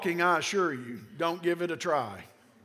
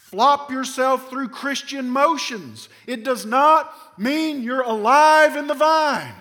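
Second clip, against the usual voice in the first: emphatic warning; 6 semitones higher, 8 dB louder, slower than usual. Real, not acted. Preacher warning against empty motions.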